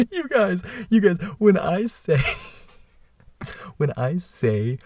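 high squealing laugh through the words. Real, not acted. Laughing as the class reaction is recalled. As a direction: laugh helplessly while trying to go on.

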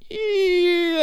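long, high-pitched squeamish squeal; recoiling noise held on a high note. Retold or imitated. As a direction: draw out a high, uneasy "eeeh". Wincing reaction to broken unit.

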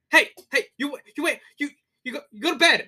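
high, halting mock scolding; imitated exasperated dad. Imitated comedian dad sending his son to bed, exasperated. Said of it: "I get mad whenever people".

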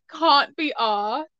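slow British long "ah" in "can't" and "asked"; imitated British pronunciation. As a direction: mimic a British accent, dragged out slowly. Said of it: "also, the way you said".

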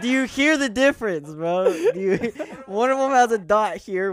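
high, loud, laughing through the question; can barely get it out for laughing. Real, not acted. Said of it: "laughing at own joke".

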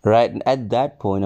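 normal talking voice

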